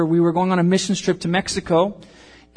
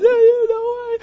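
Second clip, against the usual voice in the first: high, sobbing mock cry; crying teen; 7 dB louder, slower than usual. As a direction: imitate your younger self sobbing. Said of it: "And I was crying".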